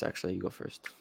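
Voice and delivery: softly handing the turn over; murmured you go first; quiet aside. Real, not acted. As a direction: drop to a near whisper.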